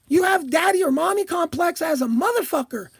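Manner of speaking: ordinary talk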